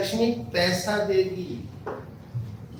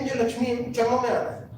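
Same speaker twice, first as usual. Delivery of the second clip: lightly voiced pleading goddess, higher pitch; a soft, higher-pitched plea to be let in; Lakshmi की याचना; 5 semitones higher, 4 dB quieter than usual. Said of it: "कहती है". (लक्ष्मी की हल्की, ऊँची आवाज़ में विनती)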